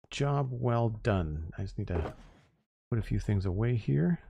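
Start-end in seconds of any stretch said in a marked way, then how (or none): 0.1-1.5 very slow, drawn-out "Job well done"; slow savoring. Real, not acted. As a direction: stretch "Job" out, softer, savoring it. Slow satisfied repeat of praise.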